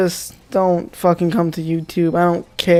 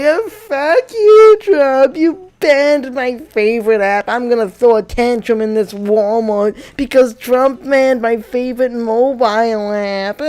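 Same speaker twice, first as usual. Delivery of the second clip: mock tantrum; 4 semitones higher than usual. Angry TikTok kid yelling at Trump, mock tantrum. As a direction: throw a hammed-up kid's tantrum. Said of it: "start getting angry at Trump".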